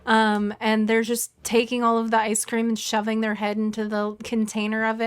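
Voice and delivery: ordinary talk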